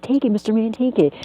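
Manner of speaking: high urgent begging; panicked plea. Retold or imitated. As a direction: push it on him in a fluster. Panicked call to friend.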